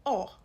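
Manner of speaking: "O" as an open aw, loud; open O sound. Shown as a demonstration. Teacher isolating the sound in la fosse, open O. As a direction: isolate O firmly as aw. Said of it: "I've just said".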